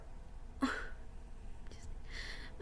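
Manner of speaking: long whispered sigh of sadness; a real sad sigh. Real, not acted. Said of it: "it makes me so sad".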